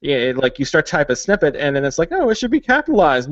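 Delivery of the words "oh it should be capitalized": high-pitched mimicry of helpful software; mock software voice. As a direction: imitate an over-eager program, pitched up. Mimicking the software's wrong assumption.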